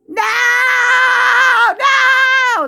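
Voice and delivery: high, loud, crying "No!"; imitating the father-in-law's crying screams; anguished screaming. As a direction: scream it like a man in agony being carried. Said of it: "Screaming! Crying!".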